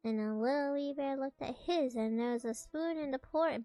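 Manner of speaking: plain reading voice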